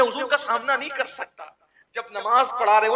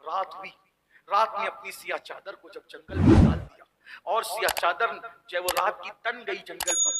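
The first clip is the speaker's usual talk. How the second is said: ordinary talk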